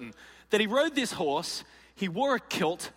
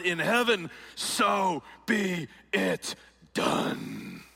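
rousing battle speech. Acted. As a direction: proclaim it like a general before battle. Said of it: "this is one of his rousing battle cries".

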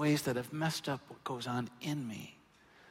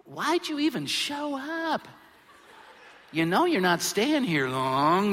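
high, slow, teasing put-down with a laugh; mock dismissive God; 7 semitones higher, 7 dB louder, slower than usual. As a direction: play a heavenly gatekeeper brushing someone off. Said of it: "he's gonna say".

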